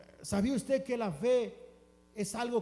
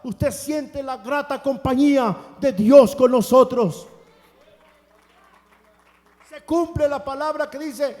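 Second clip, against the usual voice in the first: louder, higher, insistent preaching; raised preaching voice, urging faith; fervent preaching; 6 semitones higher, 8 dB louder than usual. Acted. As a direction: press the promise home. Preacher building emphatically.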